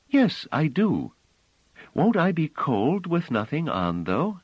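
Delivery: put-on high, slow little girl's voice; child voice. [name] asking Bliss, high childlike voice. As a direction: play the small innocent girl, up high.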